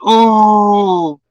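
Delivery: long, drawn-out "ooh" of onlookers; one steady, held crowd "ooh"; imitated crowd ooh. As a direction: imitate a watching crowd's reaction. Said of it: "everybody sees me fall and goes".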